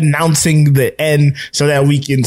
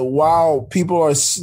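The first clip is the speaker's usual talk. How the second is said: ordinary delivery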